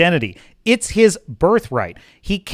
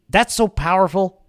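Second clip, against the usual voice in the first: ordinary talk, nothing marked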